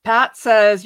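normal talking voice

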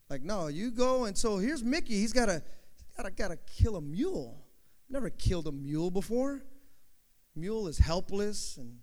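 usual speaking voice

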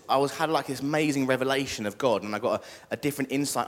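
ordinary talk, nothing marked

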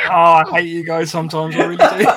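loud, laughing through the words; giggling protest, can't stop laughing. Real, not acted. Laughing at friends' teasing.

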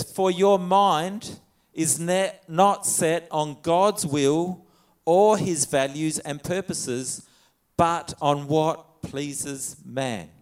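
slow, weighty reading of scripture, each word laid down. Acted. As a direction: read it out slowly, word by word, like a verse that matters.

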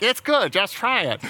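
high, loud nasal coaxing; nasally parent. Retold or imitated. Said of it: "with that nasally voice".

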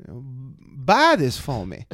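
imitating woman customer. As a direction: stretch out her request in her put-on voice. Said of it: "And she goes".

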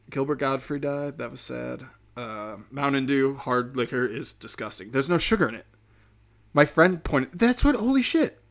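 ordinary talk

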